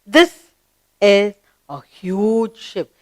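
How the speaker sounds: deliberate classroom reading; read-along model. Shown as a demonstration. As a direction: stretch the words for learners to follow. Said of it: "Let's read".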